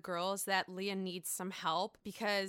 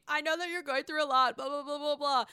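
imitating an apology; 5 semitones higher, 5 dB louder than usual. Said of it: "she's trying to apologize".

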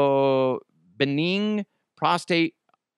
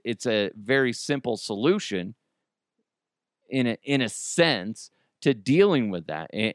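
ordinary talk, nothing marked

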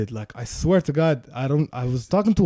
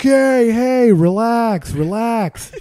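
a hint of a cop, louder, telling them to cool it; cop calming; 5 semitones higher, 6 dB louder, much slower than usual. Cop in front seat telling the arguing couple to calm down. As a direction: cop breaking up the fight. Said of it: "that's the cop speaking".

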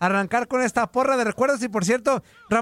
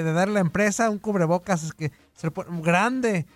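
normal talking voice

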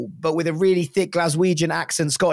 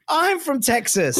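proud Texan; 8 semitones higher, slower than usual. Retold or imitated. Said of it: "And this guy goes".

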